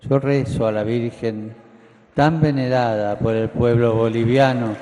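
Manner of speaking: loud spoken prayer, emphatic. Real, not acted. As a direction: proclaim the prayer to the crowd. Prays aloud to the Virgin.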